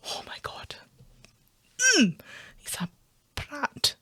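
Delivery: breathy exasperated whisper. Real, not acted. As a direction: whisper it in disbelief. Exasperated aside.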